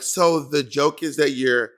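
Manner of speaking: slow, unimpressed judge repeating a joke; belittling imitation of a judge, slowly fading; Michelle. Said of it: "Michelle be like".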